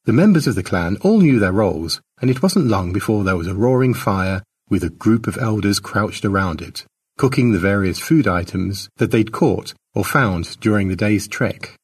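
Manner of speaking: ordinary delivery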